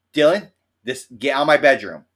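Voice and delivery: loud exasperated yell; mock yell. An annoyed friend chasing Dylan out, a loud mock yell. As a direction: shout him out of the bedroom.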